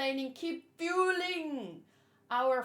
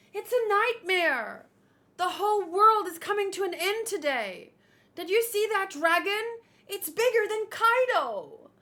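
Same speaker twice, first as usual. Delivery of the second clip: high, loud panicked crowd voices; panicked townsfolk; 6 semitones higher, 5 dB louder than usual. Acted. Reading townsfolk screaming about the fire, panicked and loud. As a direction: cry out as if the world is burning.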